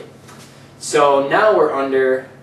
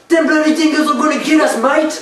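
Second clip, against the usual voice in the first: loud, high hammed-up Australian; over-the-top Australian, pitched way up; Australian accent; 13 semitones higher, 5 dB louder than usual. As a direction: holler it in a cartoon Aussie accent. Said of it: "What if I talk in an Australian voice".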